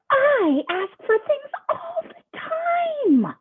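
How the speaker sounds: very high, slow, whimpering complaint; whiny pigeon wail. Acted. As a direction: wail it like a sulking child. Pigeon wails self-pity.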